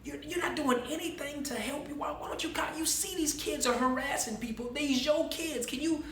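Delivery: high-pitched, hushed exasperation; irritated. Retold or imitated. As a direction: mimic a fed-up passenger's inner complaint. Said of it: "he's getting irritated. He's like".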